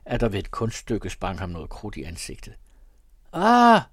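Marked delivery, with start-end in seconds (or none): 3.3-3.8 sudden loud high exclamation; loud delighted cry of Ah. A narrator's reading. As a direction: burst out with a sharp Ah. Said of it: "råbte min bror".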